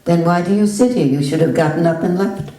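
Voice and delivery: loud and fast, impatient; brusque retort. Retold or imitated. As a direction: snap back at him.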